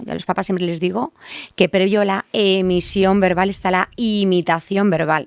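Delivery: ordinary talk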